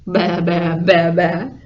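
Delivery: loud imitated bleats; insistent sheep impression; sheep bleating. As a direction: baa loudly like a sheep. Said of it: "And the sheep went".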